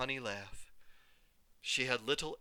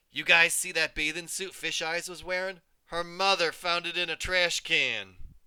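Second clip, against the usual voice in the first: mocking bully; 5 semitones higher, 6 dB louder than usual. Bully mocking to her friends.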